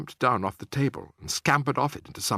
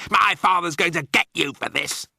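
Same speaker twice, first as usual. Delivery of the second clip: put-on higher and louder, a boy's furious threat; shrieking; 6 semitones higher, 5 dB louder than usual. A narrator's reading. Bruno threatening the narrator after falling, shrieking.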